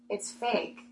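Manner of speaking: very soft, deadpan drop; flat quiet reveal. Retold or imitated. Coworker quietly telling her the winning ticket is fake, soft deadpan. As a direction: let it land softly and flat. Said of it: "and she just goes".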